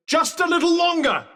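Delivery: high-pitched urging cry; excited battle call; rousing encouragement. Acted. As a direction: push them to hold out. Urging Frey on in combat.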